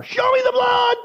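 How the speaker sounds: booming call of a stern professor; loud shout. Retold or imitated. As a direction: bellow it at the whining students. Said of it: "He would say it much louder".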